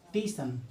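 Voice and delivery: टीसन with a long ee, no initial s; village pronunciation. Shown as a demonstration. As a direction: say टीसन as tee-san. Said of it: "कोई बोलता है टीसन". (गाँव वाला टीसन)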